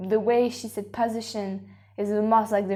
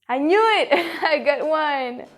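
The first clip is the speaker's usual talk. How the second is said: loud, higher burst with a laugh; real triumph; 6 semitones higher, 6 dB louder than usual. Real, not acted. Contestant who finally got one right, excited and triumphant. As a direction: celebrate a correct guess, loud and laughing. Guesses an accent correctly at last.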